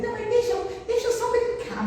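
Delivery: put-on high, coaxing girl's voice; pleading daughter. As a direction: beg mom to let her play along. Filha pedindo para brincar. (filha pedindo com voz aguda e manhosa)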